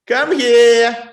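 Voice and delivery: normal talking voice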